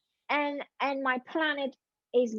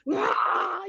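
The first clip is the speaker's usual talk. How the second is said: mock angry roar; 6 semitones higher, 4 dB louder than usual. Retelling sudden angry outburst.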